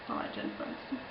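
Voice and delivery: whispered confession, very soft. Real, not acted. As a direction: let it slip as a secret aside. Whispered aside to the camera.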